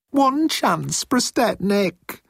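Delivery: high-pitched, drawn-out plea; begging. A narrator's reading. Moan pleads with Jeltz for mercy, begging. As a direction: beg desperately, way up high. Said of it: "Moan begged".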